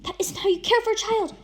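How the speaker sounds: high, loud scolding yell; furious rebuke over the baby; full volume. A narrator's reading. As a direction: tear into her at full volume. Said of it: "Mark is at her full volume".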